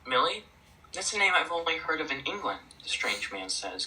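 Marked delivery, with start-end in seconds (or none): 0.1-2.6 higher-pitched, puzzled questioning; confused. Acted. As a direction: puzzle aloud over an unfamiliar name.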